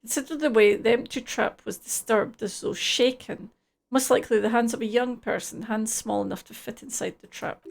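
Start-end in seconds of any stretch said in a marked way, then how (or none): none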